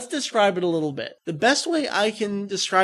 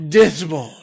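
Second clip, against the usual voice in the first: Frieza; 2 semitones lower, 7 dB louder than usual. Retold or imitated. Imitating the new death ball attack, subdued delivery. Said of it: "But this one is just".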